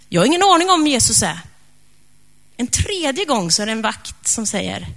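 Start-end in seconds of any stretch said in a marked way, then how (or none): 0.1-1.4 flustered denial. Acted. Petrus denies knowing Jesus, flustered.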